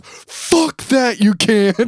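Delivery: high, loud dismissive yell; Ultimate Warrior imitation. Ultimate Warrior refusing a kid's excuse, yelling. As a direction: shout the kid down. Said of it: "He goes".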